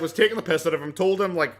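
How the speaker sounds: ordinary talk, nothing marked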